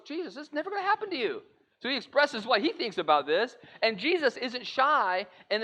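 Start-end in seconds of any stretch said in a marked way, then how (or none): 0.1-1.4 alarmed protest. Retold or imitated. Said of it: "So he goes".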